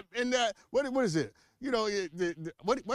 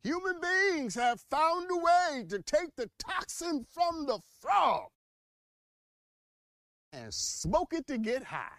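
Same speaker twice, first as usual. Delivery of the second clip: preachy voice; 7 semitones higher than usual. Pretend. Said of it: "getting preachy again".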